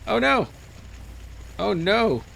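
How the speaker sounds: high, loud yelp of panic; panicked oh no. Real, not acted. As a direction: cry out as you drop. Sudden fall in game.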